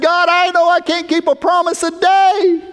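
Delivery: high, sustained cry out to God; desperate cry. Retold or imitated. Preacher reliving his prayer to God, desperate cry. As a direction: cry it out at the top of your range.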